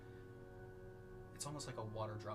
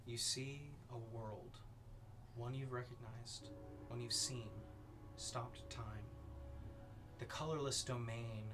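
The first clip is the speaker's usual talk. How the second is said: ominous narration; 3 dB quieter than usual. A narrator's reading. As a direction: paint the vision with dread. GM dark scene narration.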